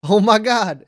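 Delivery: high, loud burst of astonishment; overjoyed amazement. Acted. Leonard hears Pax is pregnant, overjoyed amazement. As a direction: let the good news lift your voice. Joyful reaction to pregnancy news.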